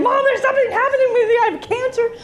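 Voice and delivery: put-on high, fast, frantic kid voice; rushed, panicky plea to mom; panicked call to mom. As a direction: blurt it out like a scared kid calling home. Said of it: "I'm like".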